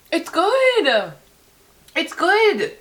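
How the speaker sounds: delighted squeal. Real, not acted. Tasting her coconut margarita, squealing with delight. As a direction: squeal it like it's the best thing ever.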